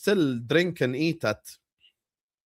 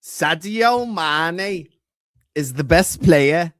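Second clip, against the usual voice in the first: drawn out, louder declaration; slow proclamation; 6 dB louder, much slower than usual. Real, not acted. Streamer proclaiming his favourite player, slow and emphatic. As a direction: stretch each word for emphasis.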